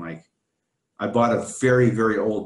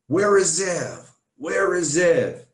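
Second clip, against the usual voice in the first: higher, slow, retold angry shouting; retold furious yelling; 7 semitones higher, 4 dB louder, much slower than usual. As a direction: replay the furious coworker calling for you, slow and high.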